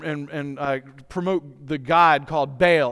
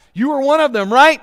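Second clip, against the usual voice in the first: accusing question; 8 semitones higher, 8 dB louder than usual. Retold or imitated. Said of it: "Peter's already been asked twice".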